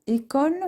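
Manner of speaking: "école" starting on a closed é. Shown as a demonstration. Teacher gives an example, "école" with closed é. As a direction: open "école" with a closed é sound. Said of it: "un E fermé".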